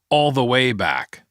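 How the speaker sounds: usual speaking voice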